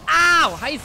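sharp high yelp of pain; walking on hot sand. Real, not acted. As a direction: yelp as if the ground burns. Burned by fire in game.

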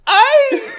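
piercing high squeal with laughter behind it; imitated yelp. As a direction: mimic a sudden loud yelp. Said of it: "he's like".

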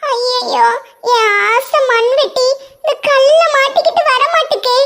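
put-on high child's voice, wailing in distress; a small boy near tears, calling out; panicky little boy. Boy Ringu wails over his stuck shovel, high and tearful.

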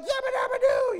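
very high loud shriek; shrieking yell. Retold or imitated. Dad shrieking back at his wife in fright. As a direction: scream it at the top. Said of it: "And I'm like".

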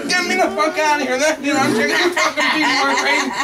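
high, loud, raging yell; freaking out. Acted. Guest yelling at the front desk to check out, freaking out. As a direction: demand to leave at full pitch. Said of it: "the guy's fucking freaking".

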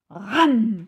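very loud snapping chomp; loud jaw snap. A narrator's reading. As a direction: bite down with a loud ram. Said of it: "fit le crocodile".

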